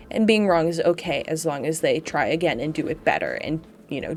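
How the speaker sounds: ordinary delivery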